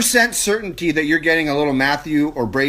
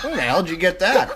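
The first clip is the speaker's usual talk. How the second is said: shocked dad; 5 semitones higher than usual. Retold or imitated. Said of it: "You'd be like".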